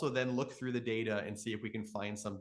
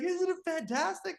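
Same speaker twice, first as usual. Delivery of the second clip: high, loud delighted call; excited yell; 4 dB louder than usual. Retold or imitated. Stranger calls back across the lake about the aurora, delighted yell. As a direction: shout your wonder across the lake. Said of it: "he's yelling from across the lake".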